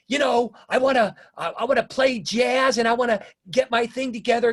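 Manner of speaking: put-on high, loud eager kid ranting; youthful rant. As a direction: gush like a hungry young player. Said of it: "I was on a little bit of a rant and going like".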